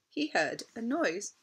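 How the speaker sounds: ordinary delivery, nothing marked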